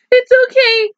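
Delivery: can't hold back the laughter; laughing through it. Real, not acted. Laughing while telling her hiccuping dog it's fine.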